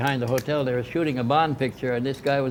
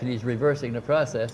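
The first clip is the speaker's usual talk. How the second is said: ordinary talk, nothing marked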